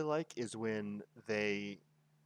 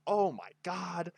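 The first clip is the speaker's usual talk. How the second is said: awed exclamation; 8 semitones higher, 5 dB louder, slower than usual. Retold or imitated.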